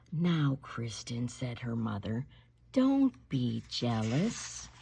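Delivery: slow, low, soft scolding; low patient don't be jealous; calm mother. Acted. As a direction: soothe a jealous child slowly.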